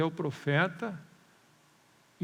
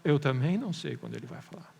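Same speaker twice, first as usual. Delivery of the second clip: lower, unbothered reply; calm answer to a protest; matter-of-fact admission; 3 semitones lower than usual. Shown as a demonstration. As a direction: admit it simply, voice dropping. Preacher's own answer to the objection.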